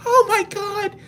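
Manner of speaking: high falsetto oh my God; high mock woman's voice; mock gasp. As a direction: squeal like a startled coworker. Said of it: "She's like".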